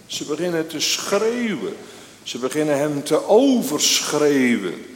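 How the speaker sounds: ringing pulpit delivery, building to a cry; impassioned preaching. Acted. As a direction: preach it loud, stretching the key words. Preacher raises voice retelling the stoning.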